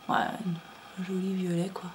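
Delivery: slow, soft whispered words. Real, not acted. Vlogger admiring her yarn, slow dreamy whisper. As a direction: savour the colour in a hushed whisper. Describing yarn colour, intimate.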